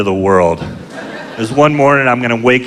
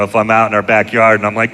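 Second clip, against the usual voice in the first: ordinary delivery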